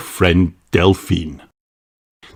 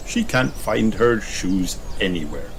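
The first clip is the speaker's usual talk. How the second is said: ordinary delivery, nothing marked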